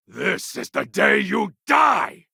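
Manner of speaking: a warlord's killing roar; death threat shout. Acted. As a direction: yell it as the charge begins. Threat at combat start.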